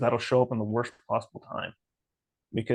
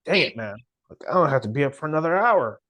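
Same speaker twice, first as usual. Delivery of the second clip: raised, louder mock complaint; exasperated grumble; 4 semitones higher, 6 dB louder than usual. Driver grumbles to himself on waking early, exasperated. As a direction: whine loudly about the early wake-up.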